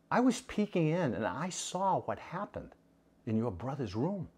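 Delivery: father quietly tells his daughter what he saw; soft fatherly. Acted. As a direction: tell her gently, almost hushed. Father speaks confidentially.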